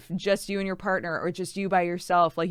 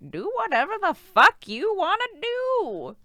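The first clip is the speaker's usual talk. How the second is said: put-on higher, slow old granny with an accent; hammed-up granny telling you to live your life; 12 semitones higher, 5 dB louder, slower than usual. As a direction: play a feisty old grandma. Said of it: "Do a granny voice".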